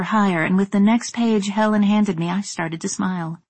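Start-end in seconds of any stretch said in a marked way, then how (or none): none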